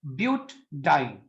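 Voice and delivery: slow ब्यूटाडाइन said "but-diene", a small catch after ब्यूट; ब्यूटाडाइन slowed, broken after ब्यूट; slight break after but. Shown as a demonstration. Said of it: "ब्यूट के बाद आप एक हल्का सा stress feel करोगे".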